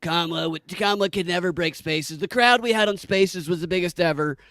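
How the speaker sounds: doing a Trump voice; Trump impression. Quoting Trump in mock voice.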